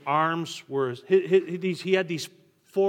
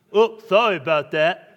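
cheery apology; 4 semitones higher, 13 dB louder than usual. Retold or imitated. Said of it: "He'd always run into you with it".

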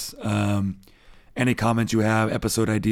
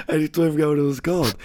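laughing; 5 semitones higher, faster than usual. Real, not acted. Laughing at own lapse.

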